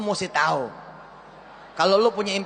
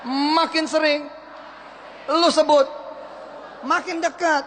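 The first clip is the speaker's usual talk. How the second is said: higher, slow rising motivational call; fired-up slogan; 6 semitones higher than usual. Shown as a demonstration. Motivator chanting the slogan, rising. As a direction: build it up like a sermon. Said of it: "Sesuatu itu".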